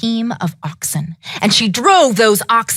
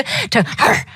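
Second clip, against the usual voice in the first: ordinary delivery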